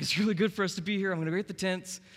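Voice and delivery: high, loud and fast eager gush; excited Peter. Retold or imitated. Peter gushing to Jesus on the mountain, excited and fast. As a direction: blurt it out thrilled. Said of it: "So Peter is all excited".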